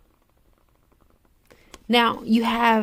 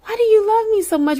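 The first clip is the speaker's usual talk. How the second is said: much higher and faster, almost squeaking; pleading disbelief; 11 semitones higher, faster than usual. Retold or imitated. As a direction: push the repeat up into a rushed, high plea. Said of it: "how I was just scared and how I would ask him".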